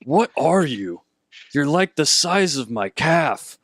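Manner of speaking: lightly voiced baffled half-orc; a hint of a scornful brute; incredulous orc. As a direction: act taken aback by a tiny creature. DM voices orc sizing up halfling.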